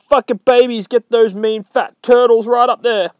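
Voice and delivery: high, loud comic screech; shouting Harley. Retold or imitated. Harley orders her hyenas onto turtles, high loud shout. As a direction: yell a hammed-up attack order.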